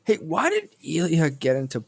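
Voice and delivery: ordinary talk, nothing marked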